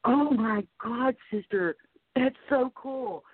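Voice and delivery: high, slow gushing quote; a mock gush of sisterly delight; excited sister. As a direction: squeal it like a thrilled sister. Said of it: "and she was like".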